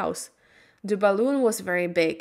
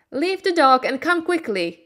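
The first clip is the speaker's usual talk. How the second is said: higher, louder urgent call; shouting; 6 semitones higher, 4 dB louder than usual. A narrator's reading. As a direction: shout to hurry her up. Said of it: "shouted the wizard".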